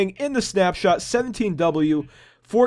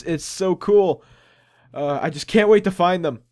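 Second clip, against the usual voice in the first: normal talking voice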